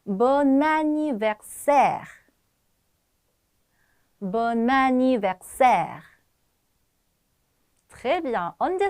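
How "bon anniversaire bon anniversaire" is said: Bon anniversaire very slow, syllables held; model pronunciation. Shown as a demonstration. Teacher lets students try the new phrase, slow model pronunciation. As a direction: model "Bon anniversaire" slowly and clearly to repeat. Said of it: "발음해 볼게요".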